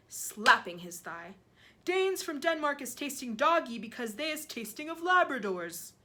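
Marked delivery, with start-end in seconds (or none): 1.9-5.9 loud, higher giant voice; giant lecturing on flavours. A narrator's reading. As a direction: hold forth emphatically as the giant.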